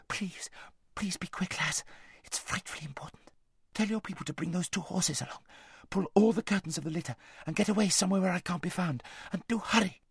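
hushed, high, urgent whisper; urgent pleading. A narrator's reading. Aravis begging her friend to hide her, urgent whisper. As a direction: plead urgently under your breath.

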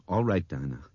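ordinary delivery

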